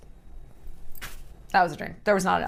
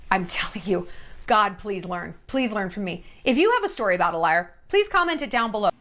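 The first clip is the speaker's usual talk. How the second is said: usual speaking voice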